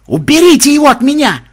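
high, loud panicked shriek; hysterical scream. A narrator's reading. Mahmud with a rabbit on his arm, hysterical scream. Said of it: "истерически завопил Махмуд".

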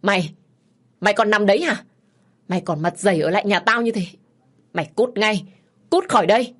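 loud, scolding tirade; angry, forceful order to get out; furious cursing. A narrator's reading. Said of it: "chửi rủa".

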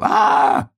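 high, loud mock scream; cried out for form's sake. Hedgehog flying above the forest, high cry. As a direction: let out a long high "aah". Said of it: "закричал".

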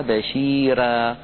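بشيرا slowly with a light thinned ra. Shown as a demonstration. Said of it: "كما يفعل في قوله تعالى".

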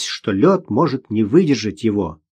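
ordinary delivery, nothing marked

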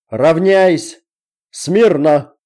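shouting drill sergeant. Acted. Officer drills formation.

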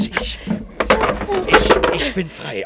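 high, loud burst of defiance; triumphant shout. Acted. Shouting freedom while breaking free from a partner. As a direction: tear yourself loose and yell it.

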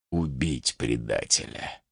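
very low, soft, slow order; commanding jarl. A narrator's reading. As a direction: pronounce the sentence slowly, deep down. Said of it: "тут же очнулся ярл". (низко и властно, как ярл)

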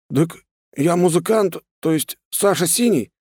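high-voiced thug correcting himself; cowed thug. A narrator's reading. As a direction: stumble into a high, hurried self-correction. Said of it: "тут же поправился он". (бандит высоким голосом, оправдываясь)

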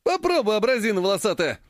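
high, bold challenge; taunting him fearlessly; defiant taunt. A narrator's reading. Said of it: "не струсил Ключ".

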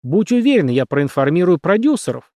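high, loud exasperated threat; angry screeching. A narrator's reading. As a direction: rail at a troublesome colleague. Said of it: "взвизгнул режиссёр".

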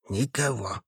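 hushed whisper. A narrator's reading. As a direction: breathe it out barely audible. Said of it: "шепнул он Маше". (шёпотом)

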